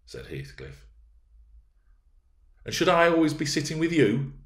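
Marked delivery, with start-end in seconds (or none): 2.6-4.3 put-on high, loud indignant girl; indignant young miss; irritated. A narrator's reading. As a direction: demand it in a raised, irritated voice. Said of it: "growing more irritated".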